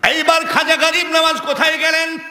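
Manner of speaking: high proclaimed question; forceful preaching. Acted. Preacher asking where Khwaja went, high forceful preaching. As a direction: throw the question out to the crowd.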